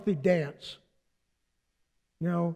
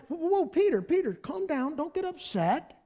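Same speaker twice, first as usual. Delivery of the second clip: soothing Jesus; 7 semitones higher, 4 dB quieter than usual. Retold or imitated. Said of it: "And Jesus is like".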